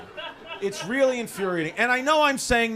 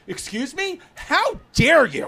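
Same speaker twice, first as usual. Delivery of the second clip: higher, indignant mock gasp; offended guy on phone; 8 semitones higher, 6 dB louder, slower than usual. Comic imitating the offended man on the phone, higher and indignant. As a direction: play the huffy offended caller.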